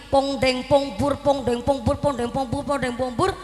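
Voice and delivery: rhythmic mouthed percussion pattern; imitating gamelan. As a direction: chant the gamelan beats evenly. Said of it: "lagi ditabui sama gendingan". (menirukan bunyi tabuhan gamelan)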